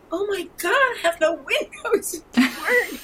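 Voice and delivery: high-pitched wail of dismay, half laughing; upset, mock panic. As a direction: re-enact your own freak-out, voice shooting way up. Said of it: "I was so upset".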